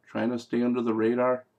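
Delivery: ordinary talk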